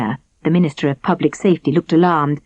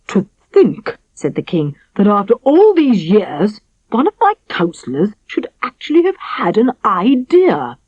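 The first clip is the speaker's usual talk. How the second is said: a hint of royal sarcasm; sarcastic king; 5 semitones higher than usual. A narrator's reading.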